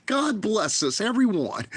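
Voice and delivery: put-on high, sweet little-boy voice; Tiny Tim impression. Vlogger doing Tiny Tim to mock fake streamers, high and sweet. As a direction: chirp it like a sickly sweet child. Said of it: "talking like Tiny Tim".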